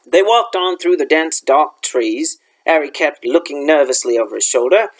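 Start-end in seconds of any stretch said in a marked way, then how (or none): none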